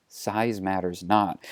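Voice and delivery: slow, low, soft pronouncement; solemn Jedi. Acted. Luke gently takes Han by the arm, solemn and soft. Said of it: "Luke approaches Han ... arm and says".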